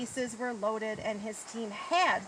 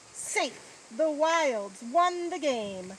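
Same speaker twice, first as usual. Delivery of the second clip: loud, raised, stretched-out announcement; triumphant call; 4 semitones higher, 7 dB louder, slower than usual. A narrator's reading. Narrator reveals the Wilds won the game, triumphant. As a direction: announce the win like a big moment. Narrator calls the winning run.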